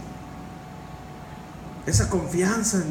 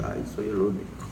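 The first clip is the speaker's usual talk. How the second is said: low, soft sigh of being alone; lonely lament; 9 semitones lower, 8 dB quieter than usual. Retold or imitated. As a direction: murmur it to yourself sadly. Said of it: "me acuerdo cuando empecé y dije".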